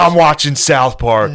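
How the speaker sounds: loud, slow, drawn-out yell; mock defiant shout. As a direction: stretch it out in hammed-up outrage. Acted defiance of a crying partner.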